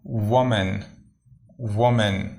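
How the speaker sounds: وومن said as "woo-men", slow and clear; woman pronounced. Shown as a demonstration. Teacher modelling وومن for students, careful and clear. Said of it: "اس کا تلفظ ہے".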